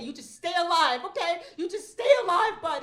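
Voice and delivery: high, loud urgent plea breaking into a laugh; hammed-up life-or-death pep talk; mock heroic urging. Mom tells son to survive, high loud mock urgency.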